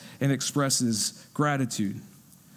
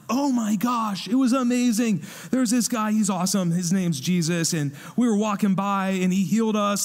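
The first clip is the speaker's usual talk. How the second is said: gushing excitement; 3 semitones higher than usual. Retold or imitated. A healed man telling an interviewer about Jesus, excited. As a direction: gush about it like it just happened. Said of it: "they would have been like".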